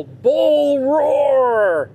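high barked "Bull roar" in a put-on voice; mock drill sergeant yell. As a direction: bellow it like a drill sergeant slamming the table. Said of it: "and yell".

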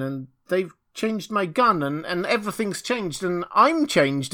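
high, bewildered outcry, halting over repeated words; at his wits' end. A narrator's reading. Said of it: "exclaimed he at his wits' end".

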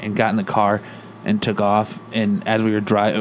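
ordinary talk, nothing marked

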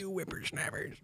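put-on high, soft old-timer scold; old prospector. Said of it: "Back in my day".

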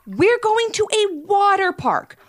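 put-on high-pitched exasperated kid; voiced boy, high and put out; impatient young boy. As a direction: whine at your sister like a brother kept waiting.

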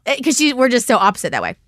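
normal talking voice

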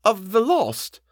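high-pitched, hesitant question; faltering. A narrator's reading. Housekeeper asks about the tormented dead, high and faltering. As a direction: offer it up high, full of dread. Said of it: "she faltered".

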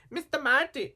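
very high mock female voice; Lorraine. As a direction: imitate her in a high squeak. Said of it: "Lorraine says something too, like".

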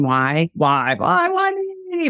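hammed-up existential moaning; angsty why me. Shown as a demonstration. Coach mocks self-pity with a whiny why me. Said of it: "Very existential and angsty".

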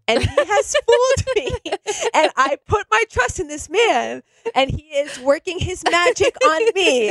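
a hint of a boxer crying through his accusation; imitating crying boxer. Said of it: "starts crying because he gives this speech".

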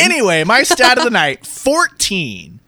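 loud, high announcer restart; big announcer voice. Real, not acted. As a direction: boom it out like a game announcer. Restarting stat after interruption.

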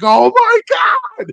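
very high, slow, loud shocked shriek; mock shriek. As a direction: shriek in mock horror at the carnage. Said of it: "her being like".

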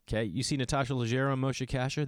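normal talking voice

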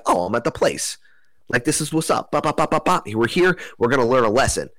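giddy mock hype with little bop sounds; excited. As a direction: pump yourself up like you just arrived. Arriving somewhere hyped on weed.

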